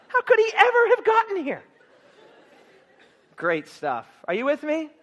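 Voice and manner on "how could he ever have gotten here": high, loud, laughing disbelief; half-laughing, can't believe it; astonished disbelief. Acted.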